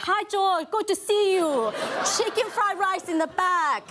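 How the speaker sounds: high, slow, hammed-up Chinese accent; shameful audition caricature accent, high and slow; heavy mock Chinese accent. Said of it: "I swear it was shameful".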